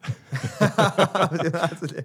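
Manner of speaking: slow laughing echo. Real, not acted. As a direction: laugh through the words. Host laughs echoing guest.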